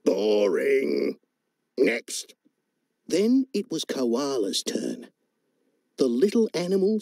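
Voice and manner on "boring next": dismissive frog voice; bored frog. Acted. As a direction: wave the act away, bored.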